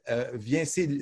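ordinary delivery

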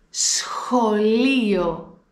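σχολείο said slowly, each sound clear, σ then χ; slow teacher demo of σχολείο; careful slow model word. Shown as a demonstration. Teacher models the word for learners, slow and clear. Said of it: "Πρόσεξε πάρα πολύ πώς θα την προφέρω".

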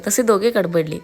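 usual speaking voice

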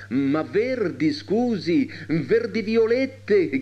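high, drawn-out playful protest; mock anger. A narrator's reading. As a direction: feign outrage, stretching the words in a high voice. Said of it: "con una collera finta, ma tanto finta". (finta collera, acuta e strascicata)

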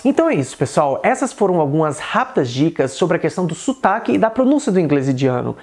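ordinary talk, nothing marked

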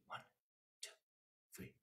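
counted softly, low and gentle. Shown as a demonstration. Teacher showing a soft dynamic pulse. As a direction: keep the pulse hushed. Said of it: "pulse could have dynamics too".

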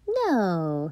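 ordinary delivery

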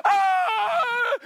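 high, loud panicked yell; panicked scream. Retold or imitated. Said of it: "'cause I'm like".

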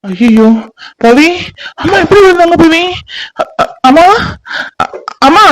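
high, tearful alarm; distraught, rising cries; shocked panic. Acted. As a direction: stammer in shock calling a parent. Son finds his mother fallen.

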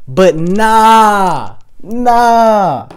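loud, high, drawn-out nah; emphatic refusal. Real, not acted. Coach rejecting the control-freak identity for viewers, emphatic refusal. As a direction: belt out a long defiant nah.

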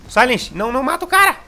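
high, loud, urgent yelling; real alarmed yell; frantic shout. Real, not acted. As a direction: shout it as the fight goes wrong. Frantic callouts in a teamfight.

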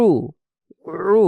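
"উ" pressed out through a tight throat; squeezed ayn. Shown as a demonstration. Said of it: "গলা চেপে হবে".

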